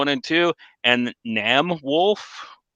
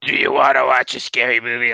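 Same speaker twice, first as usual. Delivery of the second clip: creepy caller. Acted. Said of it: "call me up and go".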